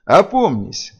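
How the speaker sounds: forceful call to come round; thundering command. A narrator's reading. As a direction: thunder it at someone out of control. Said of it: "загремел Хедин".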